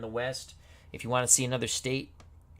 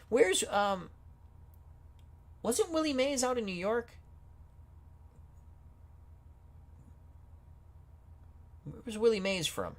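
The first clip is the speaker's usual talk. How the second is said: high-pitched, baffled questioning; puzzled; 10 semitones higher than usual. Real, not acted. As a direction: question it in high, real confusion. Puzzled, can't find Willie Mays.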